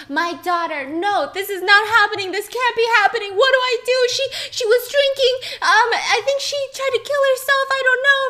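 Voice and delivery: high, loud, frantic panic; hammed-up hysterical mother on the phone. Mother panicking to the nine one one operator, hysterical. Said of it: "She's hysterical".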